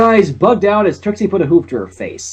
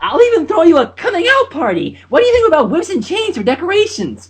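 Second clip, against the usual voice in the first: excited; 13 semitones higher than usual. Acted. Pinkie offers a party.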